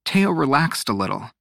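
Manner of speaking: ordinary delivery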